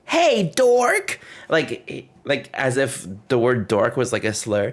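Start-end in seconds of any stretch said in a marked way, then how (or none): 0.0-1.2 put-on high, sing-song kid taunt tossed down from above; mock a giggly little kid calling names. Little kid taunting tourists from upstairs, high sing-song. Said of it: "and looking down and being like".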